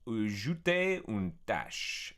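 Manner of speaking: careful French with an English speaker's accent; French, sounded out in an anglophone accent; halting amateur French. Real, not acted. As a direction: read it out in tentative learner's French. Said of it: "My French is pretty bad".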